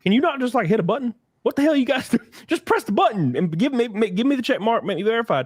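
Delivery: high-pitched, exasperated pleading; imitated frustrated nagging; retold exasperation. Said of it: "I'm like".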